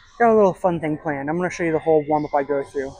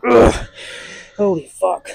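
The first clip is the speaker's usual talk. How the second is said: straining grunt; 3 semitones higher, 7 dB louder, slower than usual. Real, not acted. End of hard set.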